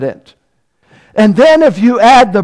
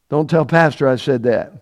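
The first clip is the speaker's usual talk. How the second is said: ordinary talk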